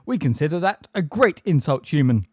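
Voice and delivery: loud, measured indignation; offended retort. A narrator's reading. Magorian answering Umbridge's insult, offended and forceful. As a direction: put her firmly in her place.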